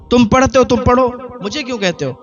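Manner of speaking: mocking wife. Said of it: "مذاق بناتی تھی، ہنستی تھی".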